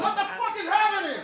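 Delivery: high-pitched shocked outburst. Retold or imitated. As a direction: squeak out total confusion. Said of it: "And I was like".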